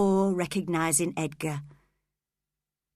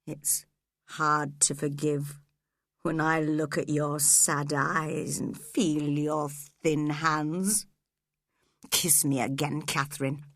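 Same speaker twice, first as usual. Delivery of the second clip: tender; 3 semitones lower than usual. A narrator's reading. Heathcliff tender with dying Catherine.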